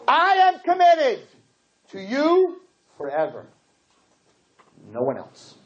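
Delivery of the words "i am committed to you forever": solemn vow. Acted. Vow quoted in sermon.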